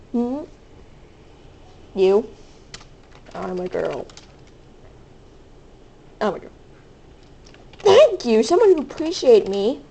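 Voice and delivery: normal talking voice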